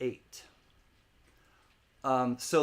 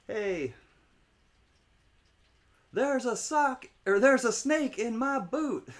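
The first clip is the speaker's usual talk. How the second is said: high, loud cowboy-toy voice, hammed-up; Woody impression; 10 semitones higher, 4 dB louder than usual. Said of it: "Different Woody".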